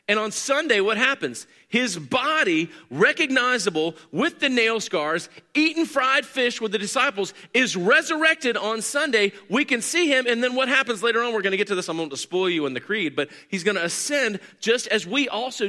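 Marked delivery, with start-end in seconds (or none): 0.1-11.6 preaching at full force. Acted. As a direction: preach it with rising fervor. Sermon climax on resurrection.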